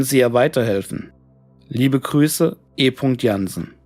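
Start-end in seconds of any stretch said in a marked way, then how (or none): none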